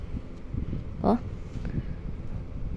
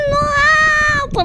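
being chased; 9 semitones higher than usual. Real, not acted.

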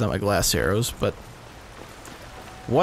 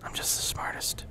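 whispered self-mockery; hushed sarcastic boast; 12 dB quieter than usual. Real, not acted. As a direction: breathe it out, ironic. Self-mocking after a mistake.

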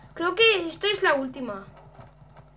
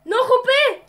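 high, loud panicked cry; 8 semitones higher, 6 dB louder than usual. Pretend. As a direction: cry out in alarm. Character in danger in game.

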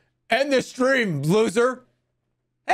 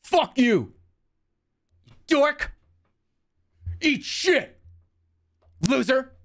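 higher, lightly voiced NPC insults; GTA NPC insults; 4 semitones higher, slower than usual. Streamer reads GTA NPC insult lines, lightly voiced and higher. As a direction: toss off canned NPC insults. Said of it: "this is what you like me to do".

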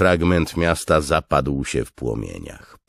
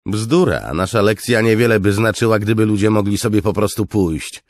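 high, loud, insistent retort; scornful officer; 9 semitones higher, 9 dB louder than usual. Acted. Officer rejects his commander's regret, scornful raised voice. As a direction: dismiss the doubt forcefully.

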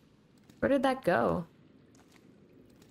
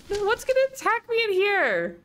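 scared; 12 semitones higher, 3 dB louder than usual. Real, not acted. Scared gamer in horror game.

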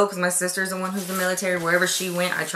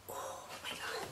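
awed whisper; 19 dB quieter than usual. Real, not acted. After a gasp at a gift.

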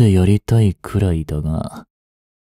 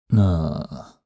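ordinary talk

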